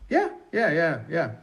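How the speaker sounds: high, quick run of yeahs; excited interjections. Acted. Acting out little reaction moments, high and excited. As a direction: pipe up high with eager agreement. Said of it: "maybe even those".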